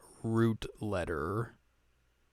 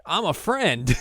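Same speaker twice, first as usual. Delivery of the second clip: 1 mock character line; 6 semitones higher, 5 dB louder, slower than usual. Mocking a minor character's line.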